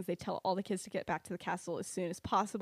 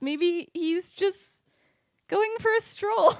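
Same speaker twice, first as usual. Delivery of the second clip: high, loud and drawn out; strained mock optimism; 10 semitones higher, 8 dB louder, much slower than usual. Lily explaining away the man following them, hammed-up hopefulness. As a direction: stretch out the hopeful excuse, climbing high. Lily makes an unlikely excuse.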